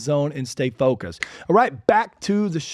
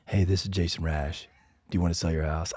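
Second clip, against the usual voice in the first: much lower, booming cold-call voice; deep bass voice; 11 semitones lower, 7 dB quieter than usual. Shown as a demonstration. Mock cold call to a homeowner, deep bass voice. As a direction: drop way down into a deep bass announcer tone. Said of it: "I just like the deep bass sound in this thing".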